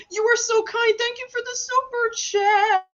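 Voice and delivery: very high, sing-song gratitude; gushing thanks. Real, not acted. Streamer thanking Juan for a super chat, high gushing delight. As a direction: gush it way up in pitch.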